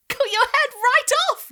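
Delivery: high, loud, slow gleeful chant; gleeful boy. A narrator's reading. Boy gleefully repeating to the dog, high and loud. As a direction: savor the gory words.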